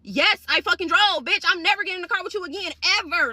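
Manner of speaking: loud, high, fast indignant comeback; acted-out exasperated outburst; angry refusal. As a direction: fire back the refusal, fed up.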